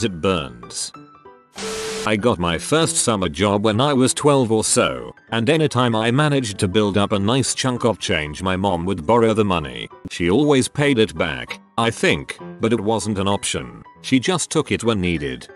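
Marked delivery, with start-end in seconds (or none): none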